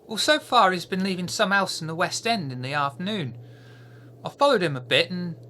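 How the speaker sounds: high, eager urchin voice reporting back; street kid Peter. Acted. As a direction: chatter like a keen little spy.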